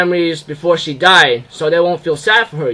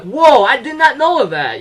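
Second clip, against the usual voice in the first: surprised reaction; 8 semitones higher than usual. Retold or imitated. Acted reaction to uncle reveal.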